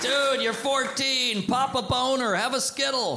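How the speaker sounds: exasperated plea. Real, not acted. Pleading with gloomy teen.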